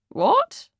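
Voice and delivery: high-pitched, baffled query; puzzled. Acted.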